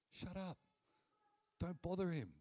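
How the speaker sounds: high-pitched mock telling-off; crowd shushing him. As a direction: act out annoyed bystanders hushing someone. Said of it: "what they said to him".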